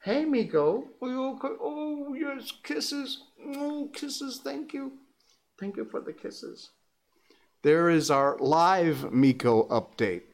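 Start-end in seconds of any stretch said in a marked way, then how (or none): 0.0-6.7 high, soft cooing at the dog. Real, not acted. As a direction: coo thanks for the kisses. Cooing at dog giving kisses.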